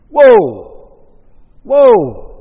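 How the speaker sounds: two loud, high cries of shock; shocked reaction. Acted. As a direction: recoil loudly as if stung by a rebuke. Reacting to Christ's rebuke of Peter.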